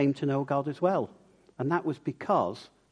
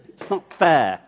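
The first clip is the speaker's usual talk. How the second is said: put-on It's not fair, louder, stamping like a child; childish tantrum; 6 dB louder, slower than usual. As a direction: throw a mock child's paddy on fair. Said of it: "stamped my foot a bit like children do".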